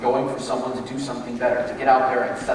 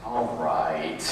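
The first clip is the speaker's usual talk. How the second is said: grudging all right; 11 semitones lower, 4 dB quieter than usual. Retold or imitated. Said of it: "it was the way you said it".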